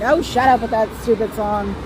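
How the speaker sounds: usual speaking voice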